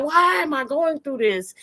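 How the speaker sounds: half-laughing, high plaintive question; mock lament. Voices adults' exasperated complaint.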